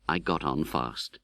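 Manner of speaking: ordinary delivery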